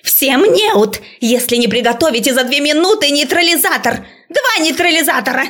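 высокий панический крик преподавательницы; требуй визгливо, с истерическим напором; визгливый крик. A narrator's reading. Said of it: "завизжала Грэдис не своим голосом". (истерично, высоко, на взводе)

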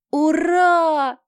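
high, loud squeak of joy; squeaky cheer. Acted. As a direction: squeal it like an eager little brother. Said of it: "пискнул Питер".

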